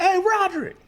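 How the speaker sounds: high, carrying street hail; calling out. Acted. Stranger hailing him by name on the street, calling out. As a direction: shout the name to catch someone's attention.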